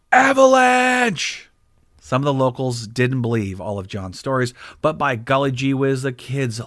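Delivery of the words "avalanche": high, drawn-out shout of alarm; dramatic shout. A narrator's reading. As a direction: yell it slow like the mountain is falling. Narrator shouts the avalanche.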